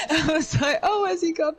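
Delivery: high, laughing mock confusion. Real, not acted. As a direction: laugh through the words.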